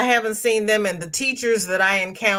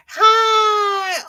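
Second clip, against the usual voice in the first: high, loud delighted hi; greeting a chat friend with a loud high squeal; excited squeal; 6 dB louder than usual. Real, not acted. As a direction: squeal hello to a friend who just showed up.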